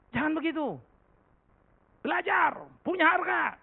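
high, loud pulpit voice; full-force sermon delivery; fiery preaching. Acted. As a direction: drive the point home to the congregation.

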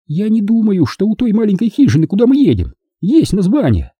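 high-pitched, hopeful question. A narrator's reading. Woman asks whether the little cabin has a name, hopeful. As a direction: ask high, hoping for a yes. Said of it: "спросила она с надеждой".

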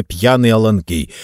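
plain reading voice

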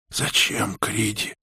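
тихо и хрипло; спроси негромко, с хрипотцой; хриплый вопрос. A narrator's reading. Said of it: "хрипло спросила Анги". (хрипло)

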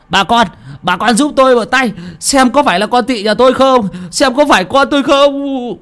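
high, urgent cry for help; pleading mother. Acted. Mother begging villagers to look at the drowned girl, high and urgent. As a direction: beg the crowd to check if it's her child.

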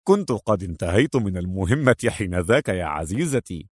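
low-voiced man, easygoing; amused, fond agent. Agent excusing himself to his partner, low and amused.

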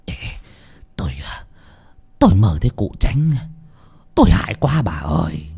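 tired, listless mumble; a shaken husband answering his wife, exhausted; weary, drained reply. Acted. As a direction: answer limp and worn out. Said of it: "Lý Đẩm uể oải".

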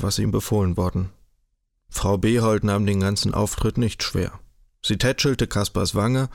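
plain reading voice

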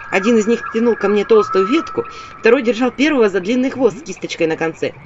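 ordinary delivery, nothing marked

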